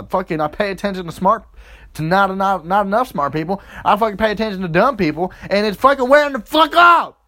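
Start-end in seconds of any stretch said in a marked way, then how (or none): 3.8-7.2 fast rant climbing to a high, loud shout; building rant. Acted. Ranting about dumb people, building from fast talk to a loud shout. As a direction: build from a quick rant into yelling.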